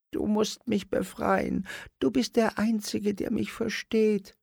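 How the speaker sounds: very high, begging little puppet voice; pleading dwarf. A narrator's reading. Dwarf Otto begs Simon to free him, very high. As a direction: plead like a desperate tiny dwarf. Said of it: "fleht Otto".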